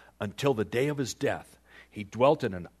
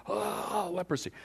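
acted shock; 8 semitones higher than usual. King getting out and finding the disease, acted shock. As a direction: exclaim as if just discovering it. King discovers his leprosy.

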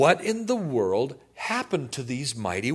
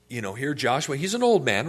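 ordinary talk

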